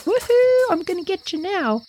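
dog bolting after prey; high excited woohoo; gleeful chase. Pretend. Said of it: "take off after it like".

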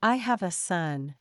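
normal talking voice

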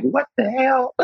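high, startled "what the hell"; startled older woman. Retold or imitated. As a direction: play her taken aback. Imitating Loretta's reaction.

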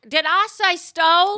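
high, loud faux-innocent question; mock innocent suspect. Mocking the accused denying theft, high and loud. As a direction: play dumb, loudly.

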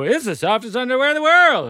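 a lightly mocked ad read, selling hard; imitating podcast ad hype. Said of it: "And then they go".

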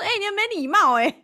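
high, loud and fast playful protest; mock offended. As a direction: scold him jokingly for being rude. She fakes offense at friend.

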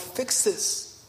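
whispered, barely voiced plea to God; a hushed, pleading whisper. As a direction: breathe it out as a desperate whisper. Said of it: "I remember telling the Lord".

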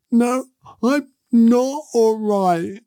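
imitating a little girl sobbing it out; retold crying. Dad imitating his crying daughter, slow tearful wail. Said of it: "she was bawling her eyes out".

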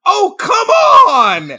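drawn-out high yell of disbelief; outraged shout. Retold or imitated. Moviegoer yelling at the screen in outrage. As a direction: bellow it slowly at the screen. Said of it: "I stood up in the theater and went".

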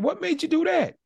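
dad pressing his son on the cologne; exasperated disbelief. Retold or imitated. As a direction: demand an explanation in disbelief. Dad confronts son over cologne.

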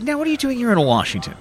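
mild impression of Boehner asking pointedly; quoting the Speaker. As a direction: echo the Speaker's pointed question, half in jest. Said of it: "And he said".

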